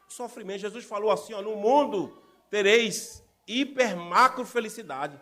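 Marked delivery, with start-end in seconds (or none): 1.4-5.2 mock misquote. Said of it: "Foi isso que ele falou?".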